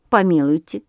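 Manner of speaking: ordinary delivery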